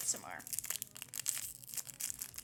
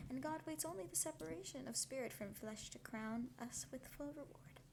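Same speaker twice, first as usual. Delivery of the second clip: story reading; 3 semitones higher, 3 dB quieter than usual. Acted. Reading novel aloud on stream.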